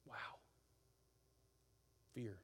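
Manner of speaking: ordinary talk, nothing marked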